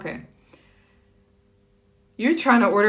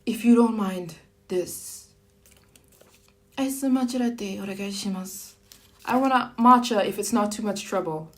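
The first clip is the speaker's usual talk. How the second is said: polite Japanese customer. Shown as a demonstration. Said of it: "you're gonna say".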